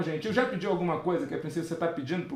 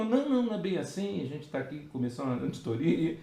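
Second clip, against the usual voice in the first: softer, meek backpedal; backing down; 3 semitones lower, 5 dB quieter than usual. Retold or imitated. Colleague faces the armed taxpayer and backs down, softer and meek. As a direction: imitate a colleague who suddenly caves, a touch meek. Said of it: "o meu colega afinou".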